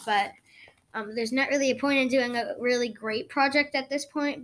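ordinary talk, nothing marked

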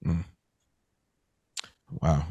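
ordinary talk

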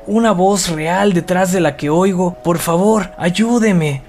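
high, slow, fading plea; desperate pleading. Acted. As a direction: plead weakly for help, slowing down. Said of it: "suplicó Mike".

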